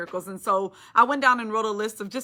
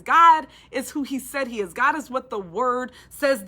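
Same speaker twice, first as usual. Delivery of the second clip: loud declaration; 2 semitones higher, 6 dB louder than usual. Real, not acted. Preacher declares God's faithfulness.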